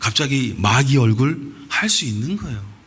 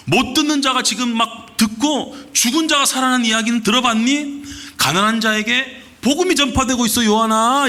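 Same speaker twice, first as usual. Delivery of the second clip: excited good news; 8 semitones higher than usual. Retold or imitated. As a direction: burst out with the wonderful news to a friend. Jesus excitedly telling John news.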